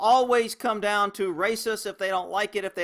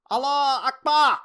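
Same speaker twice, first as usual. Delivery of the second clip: loud, high imitated shout; mimicked shout; 7 semitones higher, 6 dB louder than usual. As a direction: shout it out as retold. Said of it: "Man shouting".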